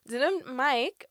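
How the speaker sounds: ordinary talk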